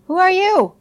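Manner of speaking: high, loud startled demand; startled question. A narrator's reading. Blind father asks who just came in, startled. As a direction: challenge a stranger, pitched right up.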